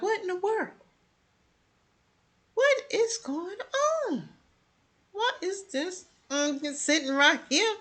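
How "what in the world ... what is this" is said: stunned. Retold or imitated. Zach watching Ian praise his date, high soft disbelief. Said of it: "And Zach is like".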